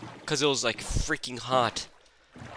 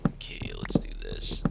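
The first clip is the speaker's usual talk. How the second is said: whisper; 6 dB quieter than usual. Real, not acted. Muttering to self while playing.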